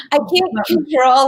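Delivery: laughing through it. Real, not acted. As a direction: start with a laugh and let it lift the words. Laughs at her own defence.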